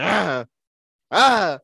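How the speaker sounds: imitating struggling noise. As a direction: re-enact a stuck sleeper's cry for help. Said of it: "So I start making noise again".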